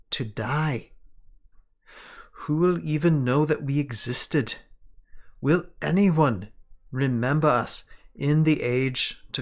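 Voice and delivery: attempted English accent, measured and deliberate with weighty pauses; elfish English accent. Sanlin writing to Shiori of lost knowledge, in an elfish English accent. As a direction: read it as an elf with an imperfect English accent. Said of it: "sounding kind of elfish, but I can't do a good English accent".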